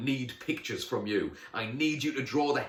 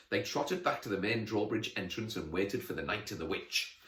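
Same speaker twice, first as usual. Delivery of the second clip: usual speaking voice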